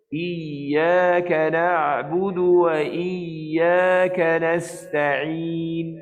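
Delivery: slow chant, tongue near the palate on ya; right tajwid recitation; correct recitation. Shown as a demonstration. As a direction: ya of Iyyaka flowing, not touching. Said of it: "itu untuk huruf ya".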